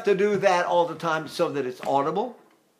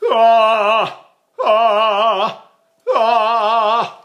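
loud ahs with a sob; sobbing sung ah; 3 semitones higher, 8 dB louder, much slower than usual. Shown as a demonstration. Voice teacher imitating a sobbing tenor. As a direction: sob into each note like an old tenor. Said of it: "if I cry a lot".

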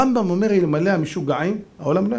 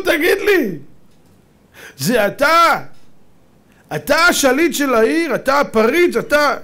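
raised, higher-pitched challenge; indignant confrontation; 5 semitones higher, 4 dB louder, slower than usual. Acted. Angry townsman confronts the governor at his house, indignant. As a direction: accuse him loudly, question after question.

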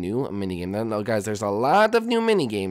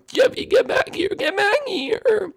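much higher, wailing yell; crying shout; slower than usual. Acted. Parent chases fleeing Jeffy, crying shout. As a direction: holler after the runaway kid.

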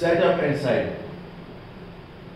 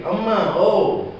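high drawn-out wail; a beggar wailing for the mother of the house; wailing call; 5 semitones higher, slower than usual. A narrator's reading. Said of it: "came a wail from the gate".

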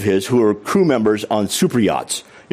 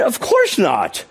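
dry retort; 10 semitones higher, much slower than usual. Retold or imitated. Dry reply to cheeky question.

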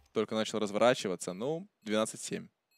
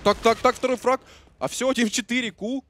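building, loud, higher hype call; excited shout; 13 semitones higher, 10 dB louder than usual. Real, not acted. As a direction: yell it as the frag lands. Casting a frag, rising.

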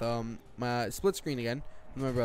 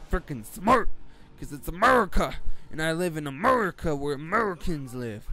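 hammed-up American accent; mock American twang; 3 semitones higher, 6 dB louder than usual. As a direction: exaggerate a dumb American accent, loudly. Mock redneck patriot bit.

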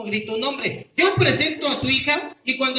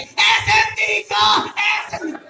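proud parent shout; 6 semitones higher, 5 dB louder than usual. Shown as a demonstration. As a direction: yell it like a wild proud mom. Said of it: "se pone bien loco y dice".

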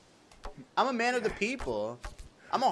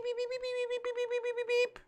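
very high, soft repeated beeps; imitated beeping; 7 dB quieter than usual. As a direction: squeak the beeps like a tiny alarm. Mocking the beep noise.

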